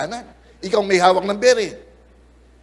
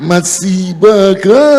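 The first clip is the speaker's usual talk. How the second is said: slow, louder teasing jab; hammed-up slow mock insult; mock taunt; 9 dB louder, much slower than usual. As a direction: drag it out to tease.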